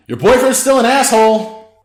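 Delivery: high, loud teasing call; yelled tease. A narrator's reading. Danny teases Lori across the house, loud playful yell. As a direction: shout the jab with a grin. Yelling between rooms.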